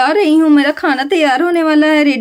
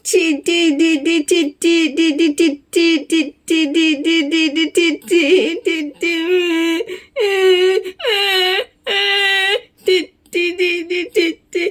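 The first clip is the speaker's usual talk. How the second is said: put-on baby crying babble; much slower than usual. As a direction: fret and whimper like a baby about to cry. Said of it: "बबुआ रोने लगता है". (रोते हुए छोटे बच्चे की धीमी बड़बड़)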